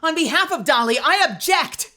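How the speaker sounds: outburst objection. Acted. Smitten defendant defends the witness, shouting. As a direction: leap up and object for her.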